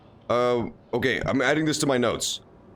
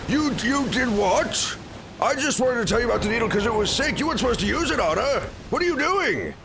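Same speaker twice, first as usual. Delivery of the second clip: very high, horrified put-on voice; appalled quest-giver impression; 12 semitones higher than usual. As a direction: squeal in mock disbelief, halting then rushing. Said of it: "he's like".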